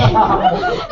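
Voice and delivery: high bubbly laugh; giddy high laughter; delighted laugh. Real, not acted. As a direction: laugh out in joy.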